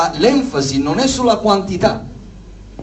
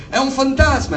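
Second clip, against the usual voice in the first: high-pitched mild cry of alarm; understated fearful outcry; frightened cry; 9 semitones higher than usual. A narrator's reading. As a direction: read it as a startled exclamation, not a scream. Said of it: "si misero a gridare dalla paura".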